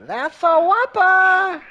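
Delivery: high, loud, slow catchphrase; catchphrase shout. Acted. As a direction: belt out the catchphrase.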